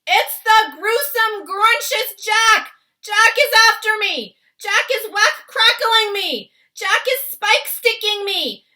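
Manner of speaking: sustained high bellow of giant terror. A narrator's reading. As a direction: holler each phrase at full blast in nightmare panic. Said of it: "bellowed the flesh lump eater".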